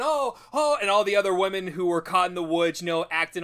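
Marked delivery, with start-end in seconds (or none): 0.0-0.8 theatrical wailing. Retold or imitated. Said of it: "she acts like".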